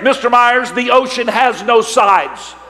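a hint of a wise instructor; instructor's lesson. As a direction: deliver the punchline like a patient teacher. Instructor's slow lesson line.

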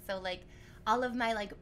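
ordinary talk, nothing marked

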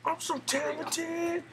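very high, silly singsong boast; gloating falsetto. Pretend. Player praises themself mid-game, playful gloating falsetto. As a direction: brag in a tiny falsetto squeak. Gloating over own play.

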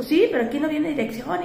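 imitating aggressive driver. Taxi driver complaining to passenger, mock aggressive. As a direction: mimic the rude driver's complaint. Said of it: "pero así muy, muy agresivo".

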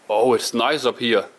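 ordinary talk

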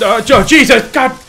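loud, high startled yelp; startled cry. Real, not acted. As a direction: jump at the scare.

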